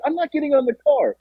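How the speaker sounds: high-pitched mock protest; acted incredulous refusal; exasperated retold refusal. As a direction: imitate a fed-up friend refusing.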